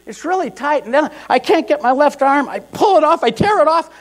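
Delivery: high, strained and frantic; fighting the shirt in a panic; frantic struggle. Retold or imitated.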